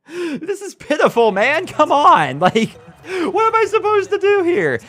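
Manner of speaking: high, slow whiny disbelief with a laugh; exasperated whine. Real, not acted. Gamer whining at his dropping receivers, high exasperated laugh. Groaning at receivers' repeated drops.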